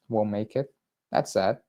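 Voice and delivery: ordinary talk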